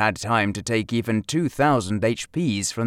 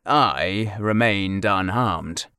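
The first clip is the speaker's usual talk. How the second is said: ordinary delivery, nothing marked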